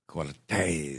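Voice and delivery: put-on drawn-out Spanish-accented Cortez, low and soft; Puss in Boots. Co-host echoes a bingo square, Puss in Boots Spanish accent. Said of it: "like Puss in Boots".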